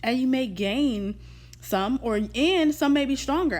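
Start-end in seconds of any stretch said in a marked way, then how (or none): none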